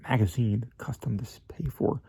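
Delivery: whispered aside about money; confiding whisper. Acted. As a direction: drop to a secretive whisper. Crabbe confides payment terms softly.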